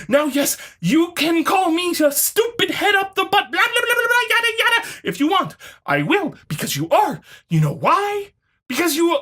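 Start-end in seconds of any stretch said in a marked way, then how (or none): 0.1-5.6 high, sing-song mock whine; mocking the opponent. Reading the opponent's words in a high sing-song mock. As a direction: parody the commenter in a silly high voice.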